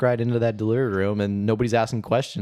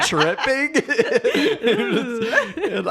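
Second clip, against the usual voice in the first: squeaky, slow words through laughter; words dissolving into high laughter; laughing talk; 4 dB louder, much slower than usual. Real, not acted. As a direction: talk while cracking up.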